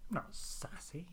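breathy whispered protest; whispered denial. Real, not acted. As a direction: whisper it defensively.

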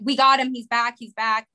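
ordinary talk

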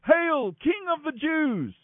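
high, slow mock salute; mocking yell. A narrator's reading. Soldiers kneel before him in mockery, yelling. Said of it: "in mockery, yelling".

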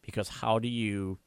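ordinary talk, nothing marked

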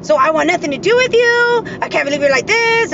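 imitating an ex's rant, high and loud; mock outrage. Ex cuts her off for making him look bad, mock outrage. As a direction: throw it out like his indignant tirade.

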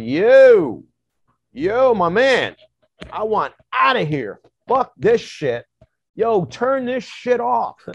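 loud, high, frantic demand to get out; freaked-out shouting. Retold or imitated. Pulling the shaman over to get out, freaking out. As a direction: yell for someone to stop it, panicking. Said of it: "I'm, like, freaking out".

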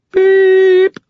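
high electronic beep. A narrator's reading. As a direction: chirp like a machine tone. Voicemail beep.

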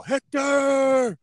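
high, loud drawn-out movie war cry; Brad Pitt yell. Acted. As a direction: bellow the name like a challenge at the gates, imitating a film. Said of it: "Brad Pitt yell".

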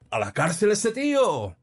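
very high outraged yell; shouting. A narrator's reading. Another churchgoer demanding jail for the drunk, high shout. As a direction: yell for his arrest. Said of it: "gritaba otro".

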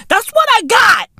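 squeaky high repeat. Acted.

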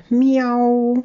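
high, loud, clear cat meow; drawn-out high meow; cat's meow. A narrator's reading. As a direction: imitate a cat calling out clearly. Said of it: "Und dann nochmal".

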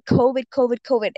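ordinary delivery

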